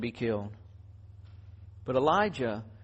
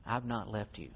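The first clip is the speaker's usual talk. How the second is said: much softer, low and tender reassurance; God's gentle voice; 5 semitones lower, 6 dB quieter than usual. Retold or imitated. God speaking softly to a struggling believer. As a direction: hush them and comfort them. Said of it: "he says".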